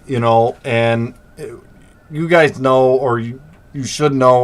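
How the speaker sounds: normal talking voice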